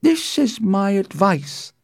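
high, deliberate counsel; measured, high-pitched nurse; slow. A narrator's reading. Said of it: "she said slowly".

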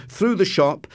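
plain reading voice

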